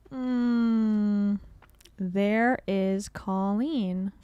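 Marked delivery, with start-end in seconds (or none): 2.0-4.1 very slow, drawn-out name call; slow announcement. Shown as a demonstration. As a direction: stretch every word out. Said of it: "repeating the name slowly".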